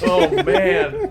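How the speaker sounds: ordinary talk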